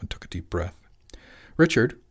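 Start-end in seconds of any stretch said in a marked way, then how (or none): 1.6-2.0 higher, louder sharp call; interrupting. A narrator's reading. Wife cutting off husband's tirade, firm interruption. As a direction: cut in firmly with the name. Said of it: "Rachel interrupted".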